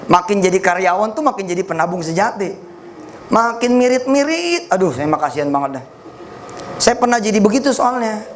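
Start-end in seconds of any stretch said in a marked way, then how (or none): 3.3-4.7 slow teasing singsong; drawn-out mock pity for misers; slowed, teasing jab at penny-pinchers. Real, not acted. Teasing stingy savers.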